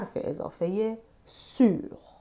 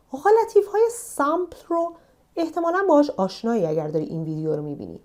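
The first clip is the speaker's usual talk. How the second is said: ordinary delivery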